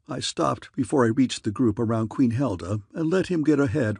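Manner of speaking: usual speaking voice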